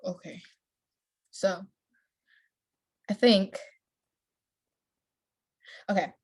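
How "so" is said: usual speaking voice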